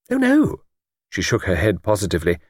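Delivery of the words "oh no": put-on high, eager elderly lady voice; chatty old lady. Old lady on the train chatting to Luke, high elderly voice. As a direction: play a talkative old lady delighted by a stranger.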